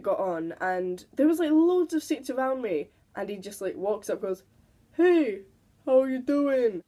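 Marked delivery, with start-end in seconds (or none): 5.0-6.8 put-on a loud, higher drunk man greeting her; loud, higher, overly friendly drunk stranger. Drunk man on the bus greets her, loud and higher.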